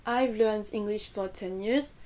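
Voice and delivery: flat French-style English, every syllable equal; French accent. Shown as a demonstration. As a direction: read it evenly like a French learner. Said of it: "cette phrase à la française".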